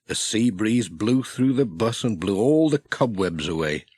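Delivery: usual speaking voice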